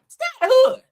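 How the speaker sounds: high, loud call-out; loud warning. Acted. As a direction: belt it out as a warning. Warning racist viewers off.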